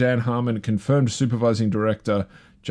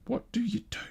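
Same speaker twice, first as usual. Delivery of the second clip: squeaked much higher and softer, helpless; high soft despair; 13 semitones higher, 9 dB quieter than usual. Real, not acted. Pondering world news, a high soft exasperated squeak. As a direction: let it rise to a small helpless squeak.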